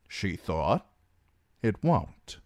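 plain reading voice

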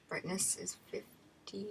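ordinary delivery

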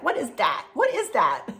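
put-on a light toddler voice asking what the goatee is; baby wondering. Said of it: "he's like".

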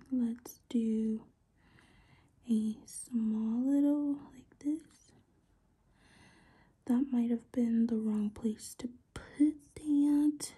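slow unvoiced whisper to self. Real, not acted. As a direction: murmur quietly while focusing. Concentrating on careful detail work.

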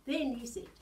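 plain reading voice

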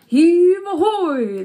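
long drawn-out, high hauling call; sailors' shout. A narrator's reading. Sailors shouting as they haul chests, a loud drawn-out call. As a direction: shout it out loud, stretching each word. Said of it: "they shouted as the chests came in".

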